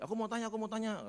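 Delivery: high-pitched Kediri Chinese friend; eager Kediri-accented mimicry; Kediri accent imitation. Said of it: "katanya, Chinese Kediri".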